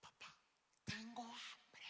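put-on child whisper. Daughter whispering she is hungry.

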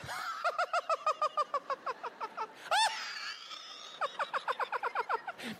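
carcajada falsa, larga y muy aguda; ríete exageradamente de cualquier cosa; risa falsa. Acted. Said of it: "esa risa falsa la aprendí de mi mamá". (risa fingida de novio que quiere caer simpático)

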